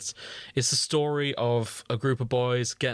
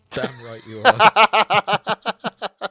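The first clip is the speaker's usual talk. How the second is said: louder, higher, drawn out with a laugh; gleeful cheer; 9 semitones higher, 11 dB louder, much slower than usual. Real, not acted. Cheering the author's note.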